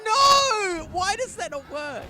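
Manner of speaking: loud exasperated cry; frustrated shout. Real, not acted. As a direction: yell at the broken game. Game action fails.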